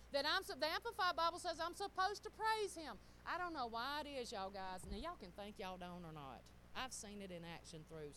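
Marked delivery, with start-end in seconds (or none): none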